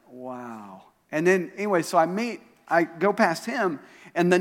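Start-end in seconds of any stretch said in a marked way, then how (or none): 0.1-0.9 soft, low, awed reaction; hushed amazement. Retold or imitated. Preacher retelling his reaction to the tattoo, quietly amazed. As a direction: let it drop low in wonder.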